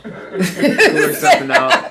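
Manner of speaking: high-pitched, laughing repeat; amused, words breaking into laughs. Real, not acted. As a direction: say it through real laughter. Amused repeat of a discount answer.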